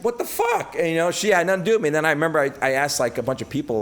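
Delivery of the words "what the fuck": what the fuck jumping much higher in disbelief; shocked, pitch leaping up; incredulous what the fuck. Retold or imitated. Spotting her with another guy, a high disbelieving squeal. Seeing her with guitar player.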